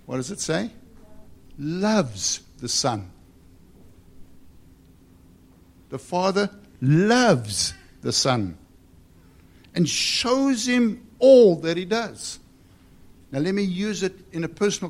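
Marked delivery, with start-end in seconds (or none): 1.6-8.6 "Loves" drawn out slowly and swelling; stress on Loves. Shown as a demonstration. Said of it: "underline that next word". 9.8-12.4 loud, high, stretched preacher's delivery; forceful scripture reading. Acted.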